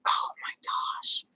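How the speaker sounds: hushed, breathy whisper of wonder; awed whisper. Real, not acted. Vlogger showing viewers her fancy hat, awed whisper. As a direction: whisper as if the hat is too lovely to speak over.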